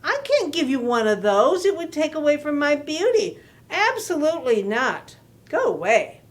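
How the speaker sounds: loud, haughty, offended refusal; indignant outburst. Acted. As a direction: huff at the little fish, how dare he.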